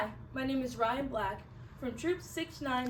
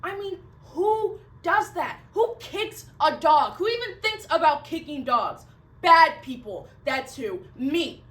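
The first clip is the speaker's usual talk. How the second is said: loud, emphatic outburst, punching the key words; appalled self-reproach; 4 semitones higher, 9 dB louder than usual. Acted. Dog kicker blaming themself as a bad person, appalled. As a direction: berate yourself loudly, hammering each question.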